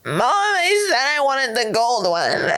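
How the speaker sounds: high, drawn-out mock whine; imitating bratty crying. Spoiled girl to mom over a Ferrari, mock crying whine. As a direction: wail it like a spoiled teen's fake tantrum. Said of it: "stomp my feet and cry".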